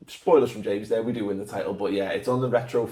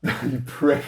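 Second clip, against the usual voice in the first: ordinary delivery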